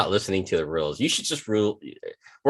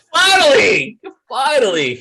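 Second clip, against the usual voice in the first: high, loud, tearful-laughing relief; laughing triumph; 8 dB louder than usual. Real, not acted. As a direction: crow with relief through laughter. Joke finally lands.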